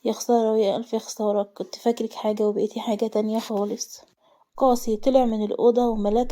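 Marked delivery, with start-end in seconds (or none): none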